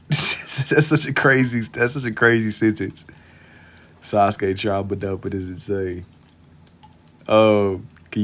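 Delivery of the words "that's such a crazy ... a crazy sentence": laughing through the words, slow and loud; can't stop laughing at it. Real, not acted. Laughing at the request.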